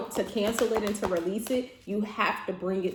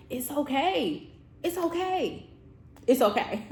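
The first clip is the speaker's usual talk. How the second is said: reassuring; 5 semitones higher than usual. Retold or imitated. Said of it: "talking to my friends and my family".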